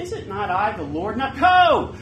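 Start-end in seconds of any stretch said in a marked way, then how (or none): 1.2-2.0 high, loud sending-off; commanding shout. Acted. Preacher voices the Lord sending someone out, commanding shout. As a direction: shout it as an urgent order.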